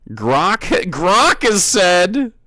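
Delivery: slow, loud mock pronouncement; sarcastic proclamation. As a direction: announce it sarcastically. Mocking chatter trusting Grok.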